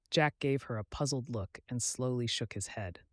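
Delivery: plain reading voice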